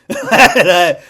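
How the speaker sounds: loud laugh on a word. Real, not acted. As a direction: burst out laughing. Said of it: "laughing burst".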